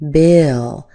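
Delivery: Bill held longer, a schwa before the L; lengthened Bill. Shown as a demonstration. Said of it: "we get a little more length".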